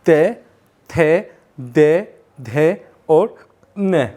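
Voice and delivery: "ते, थे, दे, धे और ने" spaced out, tongue touching the teeth; teacher leading the class through dental sounds; slow dental drill. Shown as a demonstration. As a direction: drill "ते थे दे धे ने" syllable by syllable, slowly. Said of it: "बोलिए".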